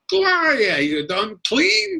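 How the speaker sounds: very high, stretched-out mock nag; mimicked nagging grandmother. Son teasing his nagging mother, high mock-grandmother voice. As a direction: tease by imitating grandmother's drawn-out scolding. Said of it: "she nags me, I go".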